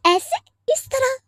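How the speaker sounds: high, scornful question; mother scoffing at the father's gentle scolding, high and mocking; mocking disbelief. Mother mocks father's soft scolding.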